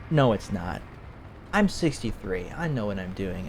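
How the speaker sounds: low, quiet brush-off; retold stubborn denial. Scammed elder brushing off a warning, low dismissive denial. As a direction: insist quietly, dropping away. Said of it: "and they're like".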